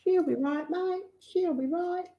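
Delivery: high, hammed-up Aussie accent; aussie tradesman. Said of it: "That's a typical Aussie saying".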